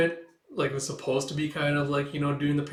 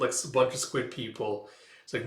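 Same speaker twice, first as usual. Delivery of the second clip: ordinary delivery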